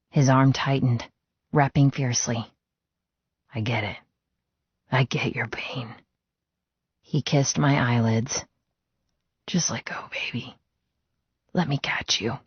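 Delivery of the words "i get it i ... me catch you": soft whisper. Acted. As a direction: lull her with a hushed vow. Arthur whispers comfort.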